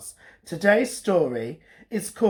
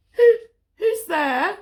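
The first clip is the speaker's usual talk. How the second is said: very high, slow, louder owl; startled owl; 14 semitones higher, 5 dB louder, slower than usual. Acted. Olive startled by the growl. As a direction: ask nervously like a tiny owl. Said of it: "called Olive".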